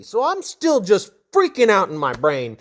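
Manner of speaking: ordinary delivery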